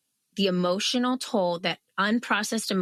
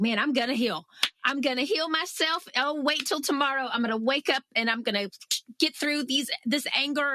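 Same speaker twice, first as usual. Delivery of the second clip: determined; 5 semitones higher, faster than usual. Retold or imitated. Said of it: "was like".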